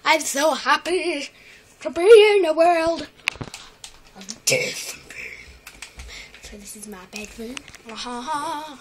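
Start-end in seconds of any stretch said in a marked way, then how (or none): 0.0-4.9 put-on slow, louder voice for the baby animal; mock animal voice. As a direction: give the little one a voice, darkly comic. Voicing the animal ironically.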